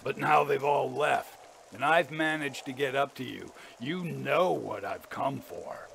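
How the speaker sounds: understated menace, slowing at the end; menacing. A narrator's reading. Father tells his son why he came, with menace. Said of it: "distinct menace in his tone".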